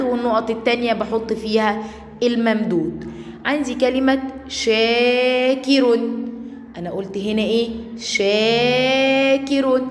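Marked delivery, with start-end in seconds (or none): 4.5-6.0 شاكر with a very long "shaa", then "ki-run"; higher, drawn-out alif in شاكر; long-vowel demonstration. Shown as a demonstration. Teacher shows first graders the madd, stretched "shaaa-kirun" for شاكر. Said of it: "هنا المد إيه؟". 8.0-9.8 شاكر with a very long "shaa", then "ki-run"; higher, drawn-out alif in شاكر; long-vowel demonstration. Shown as a demonstration. Teacher shows first graders the madd, stretched "shaaa-kirun" for شاكر. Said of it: "هنا المد إيه؟".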